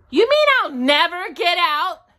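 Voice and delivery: high, loud, alarmed question; dismayed question. A narrator's reading. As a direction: ask it in rising dismay. Pooh dismayed at being stuck.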